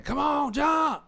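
high, loud call from the poolside; yelling. Retold or imitated. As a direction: shout up to the diving board. Cousin yells from poolside.

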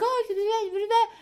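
high, bright mock imitation; squeaky imitating voice. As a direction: mimic her in a squeaky voice. Friend voiced mocking.

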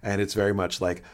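normal talking voice

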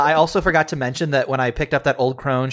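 ordinary delivery

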